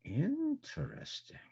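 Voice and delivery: high, slow and intrigued; high curious drawl. Acted. Character sees own shadow.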